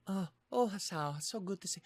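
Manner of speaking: high, soft Lily voice attempt; Lily's voice. Greeting Hasao as Lily, high soft voice. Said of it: "since I've done Lily's voice".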